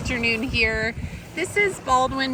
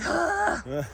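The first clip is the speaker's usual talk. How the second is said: irritated huff; 7 semitones higher than usual. Retold or imitated. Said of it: "I'm just like".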